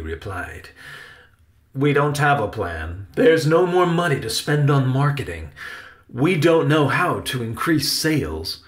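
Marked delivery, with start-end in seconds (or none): 1.7-8.6 loud, projected, mildly exasperated uncle; emphatic actor uncle; frustrated Uncle Riley. Acted. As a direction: admit it with firm stage emphasis. Uncle admits no plan.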